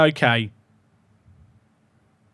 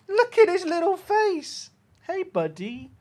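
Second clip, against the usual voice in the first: pet talk; 10 semitones higher than usual. Real, not acted. Cooing at the puppy.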